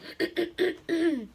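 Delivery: loud, showy throat-clear; exaggerated throat clearing. Storyteller about to read the letter aloud, pointed throat-clearing. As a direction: clear your throat pointedly before reading. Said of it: "let me just clear my throat".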